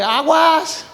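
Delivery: aguas called out high and urgent; warning cry. Shown as a demonstration. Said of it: "Así como que".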